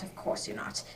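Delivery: breathy whisper to himself; whispered aside. Acted. Holmes whispers to himself while humouring his friend. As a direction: drop it to a private whisper.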